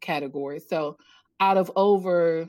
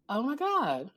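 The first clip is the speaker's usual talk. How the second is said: soft, higher retold gasp of revelation; awed realization; 5 semitones higher, 4 dB quieter than usual. Author realizing the phrase names her struggle, awed. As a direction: let it dawn on you, hushed.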